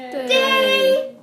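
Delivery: overdone teacher stress on de; exaggerated de. Shown as a demonstration. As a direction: hit de hard and high so nobody forgets it. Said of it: "Why do I always exaggerate the de?".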